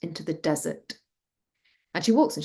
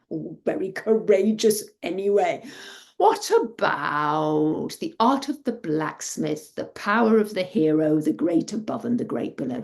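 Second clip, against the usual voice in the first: tipsy, expansive father. A narrator's reading. Drunk father offering treasures to daughter, expansively. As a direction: stretch out the offer with boozy grandeur.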